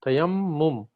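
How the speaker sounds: "Tayammum" spelled out by ear as ta-yamm-mum; emphasized double M. Shown as a demonstration. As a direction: teach "Tayammum", leaning on the doubled m. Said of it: "there's a double M there".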